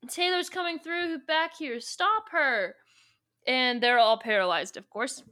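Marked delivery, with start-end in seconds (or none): 0.1-2.8 high, urgent calling out; mock alarm call. Warning the others that Taylor is coming, high mock alarm. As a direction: raise the pitch to alert the others.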